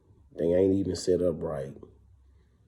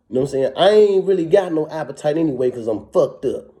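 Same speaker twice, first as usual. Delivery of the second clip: higher and louder, indignant and heated; worked-up rant; 8 semitones higher, 7 dB louder than usual. Real, not acted. Ex-inmate venting about being fed spoiled food far from home, worked up and louder. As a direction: let the grievance boil over.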